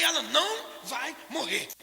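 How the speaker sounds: drawn-out, unshakable "Ela não vai morrer"; slow emphatic insistence. Retold or imitated. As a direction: lean on every word and refuse to give in. Repeats the denial more emphatically.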